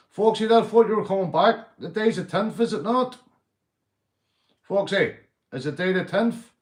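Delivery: calling out. Real, not acted. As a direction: hail your mate loudly. Calling out to viewer.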